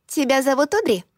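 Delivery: put-on lightly squeaky little-girl voice; a hint of a piping childlike tone. A narrator's reading. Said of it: "с детски песклявым голосом".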